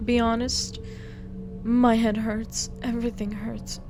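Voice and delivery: ordinary talk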